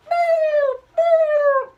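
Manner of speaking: nasal boo with a pinched nose; pinching nose. Shown as a demonstration. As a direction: hold your nose and wail boo. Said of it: "plug your nose like this".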